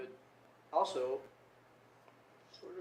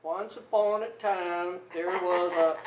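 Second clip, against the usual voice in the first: higher, loud, drawn-out storybook reading; slow, imitated schoolboy read-aloud in an accent; child reading aloud; 5 semitones higher, 12 dB louder, slower than usual. New kid reading for classroom visitors, slow accented recital. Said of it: "she'd give me something to read".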